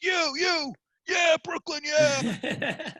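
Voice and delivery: very high, loud, laughing Brooklyn cheer; the Brooklyn guy cheering back, laughing. Retold or imitated. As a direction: whoop it up like the guy on the rock. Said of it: "He goes".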